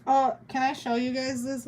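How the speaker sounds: ordinary talk, nothing marked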